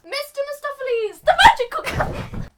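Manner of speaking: very high, loud showy cry; hammed-up announcement. Mistoffelees announces himself, high and loud. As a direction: introduce yourself in a high squealed fanfare. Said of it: "Mister Mistoffelees goes".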